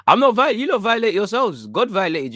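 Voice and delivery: high, loud mock outrage; mock protest. Real, not acted. Streamer protesting to chat with loud mock outrage. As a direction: throw the blame back, laughing.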